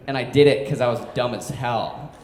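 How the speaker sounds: usual speaking voice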